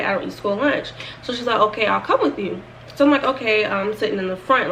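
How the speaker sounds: ordinary talk, nothing marked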